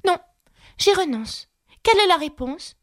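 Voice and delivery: voix de petite fille plus aiguë; Alice. A narrator's reading. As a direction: une fillette qui abandonne la devinette. Alice's voice in narration. (Alice, voix aiguë de fillette)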